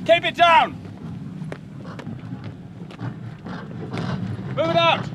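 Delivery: high, urgent shouted orders; alarm shout. Acted. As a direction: bark the orders over gunfire. Soldiers shouting orders under fire.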